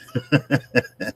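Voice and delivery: short run of chuckles after a joke; real laugh. Real, not acted. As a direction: laugh at your own joke.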